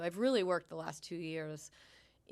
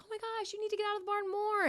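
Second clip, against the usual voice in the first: much higher, excited gushing praise; gushing fan; 4 dB louder, faster than usual. Retold or imitated. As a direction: play an over-the-moon customer. Quoting enthusiastic market visitors.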